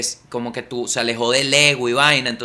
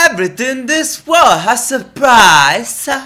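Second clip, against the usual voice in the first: high, loud, drawn-out mock British; British accent; 8 semitones higher, 7 dB louder, much slower than usual. Lover names his terms in English, hammed-up British accent. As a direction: ham up a slow, high-pitched British accent. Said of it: "más acento británico".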